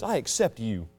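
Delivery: soft, low, tender acceptance; God's gentle voice. Acted. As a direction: say it softly as God welcoming you. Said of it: "so God says".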